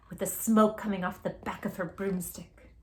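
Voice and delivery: ordinary delivery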